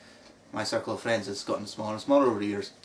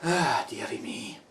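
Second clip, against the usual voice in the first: mock Scottish sigh; slower than usual. Teasing the fake Scotsman, slow mock Scottish accent. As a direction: sigh it like a weary old Scot. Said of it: "broad Scottish accent like you do".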